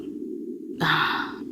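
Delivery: soft, breath-only sigh; heavy sigh. Acted. Villain weighing whether it was their fault, heavy sigh. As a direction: sigh before a hard answer. Villain pauses before answering own question.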